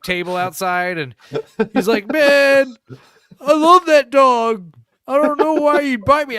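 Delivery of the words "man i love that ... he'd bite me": much higher, much louder mock-wounded friend, slow at first; hammed-up whiny wail. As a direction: wail it like a bitten drunk buddy. Bitten friend whining after tantrum.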